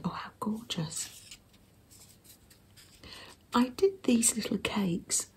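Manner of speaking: soft close whisper; ASMR whisper. Real, not acted. Showing a handmade zine to viewers in a gentle whisper. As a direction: hush every word for the listener. ASMR journal flip-through.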